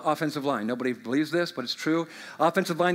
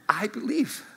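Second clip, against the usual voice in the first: whispered prayer; 5 dB quieter than usual. Acted.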